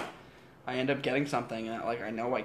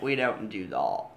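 mock British accent; 2 semitones lower, 5 dB louder, slower than usual. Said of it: "in a British accent".

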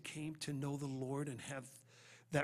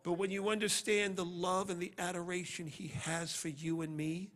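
normal talking voice

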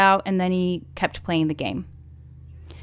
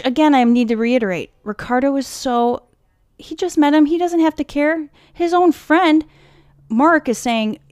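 heated; 7 semitones higher, 5 dB louder than usual. Real, not acted. Heated defence of Ricardo.

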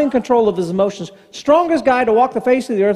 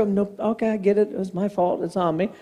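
soft humble admission; 7 dB quieter than usual. Pretend.

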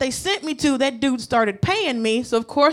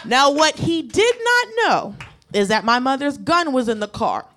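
ordinary talk

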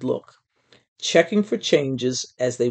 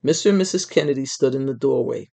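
plain reading voice

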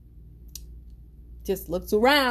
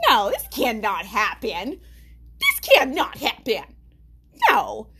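emphatic disbelief; 2 semitones higher, 5 dB louder than usual. Retold or imitated. Retold refusal on seeing a gun.

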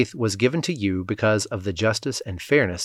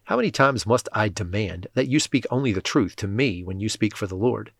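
ordinary delivery

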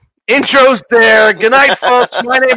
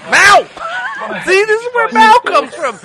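laughing; 13 semitones higher than usual. Real, not acted. Laughing after movie clip.

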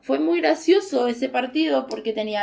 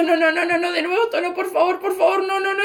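higher, pleading no no no as if in tears; imitated crying plea; 6 semitones higher than usual. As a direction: beg through mock sobs, pitched up. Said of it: "llorando, diciendo". (súplica llorosa imitada, más aguda)

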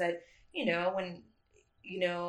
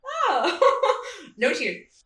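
high, loud laughing "Oh"; a bubbly laugh of relief; 9 semitones higher, 9 dB louder, much slower than usual. Retold or imitated. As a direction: squeal then laugh through "no tears". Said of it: "I was just like".

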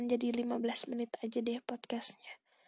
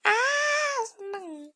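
excited squeal; 12 semitones higher, 8 dB louder, much slower than usual. Real, not acted. Gushing about seventeen.

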